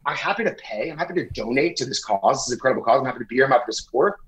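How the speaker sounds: easygoing offer that snaps into a fast refusal; polite at first, then a quick curse; mock polite then blunt. Turns down the barbecue line, polite then a fast curse. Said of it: "I say".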